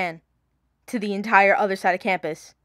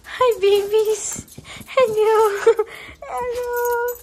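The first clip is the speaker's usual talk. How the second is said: excited dog greeting; 11 semitones higher, 4 dB louder, much slower than usual. Real, not acted. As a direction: squeal hello to your dog. Greeting her dog after coming home.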